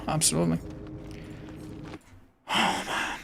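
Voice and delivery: breathed under the breath; exasperated whisper. Real, not acted. Streamer whispering in disbelief at hopeless teammates. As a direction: sigh it out without voice. Disappointed groan.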